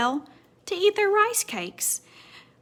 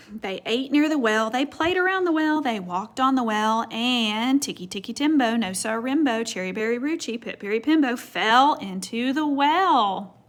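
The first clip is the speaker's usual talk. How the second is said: usual speaking voice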